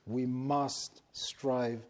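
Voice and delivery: must leaned on, slow; stress on must. Shown as a demonstration. Preacher to his church, stressing must. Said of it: "Notice I've used the word must".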